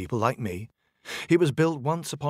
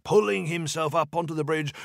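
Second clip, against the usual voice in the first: plain reading voice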